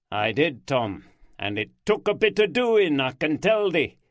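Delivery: put-on broad Yorkshire accent, heightened. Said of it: "heightening their accents and sometimes using a bit of Yorkshire".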